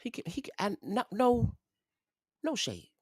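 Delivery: hesitant and high-pitched, backing off; delicate hedging. Real, not acted. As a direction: tiptoe around the insult up high. Delicately doubting someone can read.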